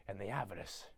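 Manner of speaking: low, very soft aside; hushed mock disgust. Grinch lamenting greed to the Whos, low hush. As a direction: drop right down low and quiet.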